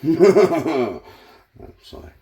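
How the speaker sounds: giggling through it; laughing as you apologise; laughing apology. Real, not acted.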